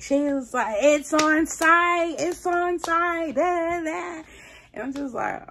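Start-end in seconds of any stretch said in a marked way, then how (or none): 0.8-4.2 high, slow mock threat from the caller; drawn-out taunting "It's on site" pitched well up; upset threat. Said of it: "So she gets upset".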